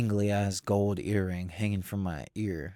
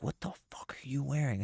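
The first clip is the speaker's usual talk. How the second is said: hushed whisper, half-voiced; 5 semitones higher, 7 dB quieter than usual. Brother whispering at the interview, hushed disbelief. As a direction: whisper it urgently as if not to be overheard. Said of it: "whis-, he was like".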